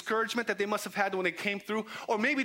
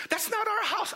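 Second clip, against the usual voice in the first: very high-pitched objection; squeaky protest; 3 dB louder than usual. Acted. Friend objects to climbing a stranger's roof, squeaky protest.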